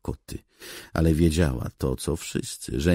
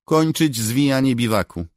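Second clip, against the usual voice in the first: higher, louder crisp order; officer's command; 12 semitones higher, 9 dB louder than usual. Acted. Officer orders soldiers to break camp, commanding. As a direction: bark the order at the troops.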